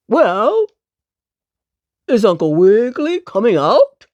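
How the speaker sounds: dog soldier calling loudly. Acted. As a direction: call out to the house, demanding. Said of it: "called the dog again".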